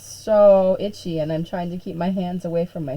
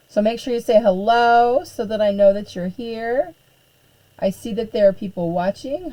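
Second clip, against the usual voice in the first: ordinary delivery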